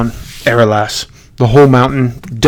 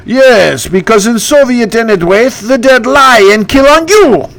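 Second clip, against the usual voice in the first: higher, hammed-up ghost king punchline; mock Soviet joke, raised; Bertu voice; 8 semitones higher than usual. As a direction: ghost king's gloating twist. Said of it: "that's my impression".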